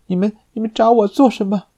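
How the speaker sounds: high, fast, stammering fear; frightened. Acted. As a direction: stammer it out in panic. Milady cornered, stammering.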